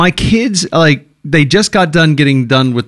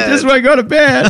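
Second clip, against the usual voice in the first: mock whine; 13 semitones higher than usual. Both joke about wanting bed.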